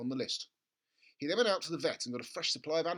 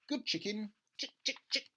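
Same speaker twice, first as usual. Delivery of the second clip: higher, gentle calling; a boy calling a hen, higher; coaxing chicken; 7 semitones higher than usual. Acted.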